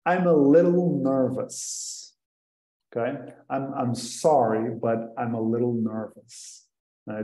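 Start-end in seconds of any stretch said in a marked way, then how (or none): 0.1-2.1 slow and careful; slow model sentence. Shown as a demonstration. Teacher modelling sentence four for learners. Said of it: "Sentence number four". 3.5-6.7 softly, a touch hesitant, apologizing; nervous learner. Shown as a demonstration. Learner apologizing to a native speaker, a touch nervous. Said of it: "É uma boa frase para, ah, acalmar".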